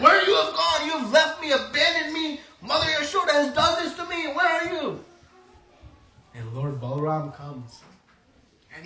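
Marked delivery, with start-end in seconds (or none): 0.0-5.0 high, loud upset wailing; the abandoned child throwing a fit; mad child. Acted. Tied Krishna complains he was abandoned, high tantrum wail. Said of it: "And he becomes mad".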